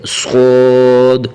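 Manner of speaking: صاد said thick, full and drawn out; heavy saad. Shown as a demonstration. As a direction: fill the mouth for a heavy صاد. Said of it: "پر کر کے".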